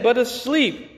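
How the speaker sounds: high, softened "asleep"; gentle correction. Acted. Jesus telling the wailing crowd the child sleeps, lifted and gentle. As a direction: lift "asleep" up lightly.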